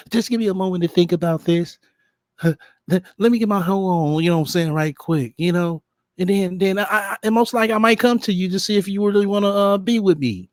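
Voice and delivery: high, put-on prissy woman's voice; mocking impression of her. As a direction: parody her excuse, dripping with sarcasm. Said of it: "that's basically what the bitch saying".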